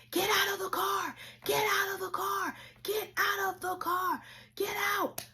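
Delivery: high urgent yelled commands; high-pitched yelled orders at a crashed car; police shouting. Retold or imitated. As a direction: yell it like a cop pulling someone out. Police banging on car window.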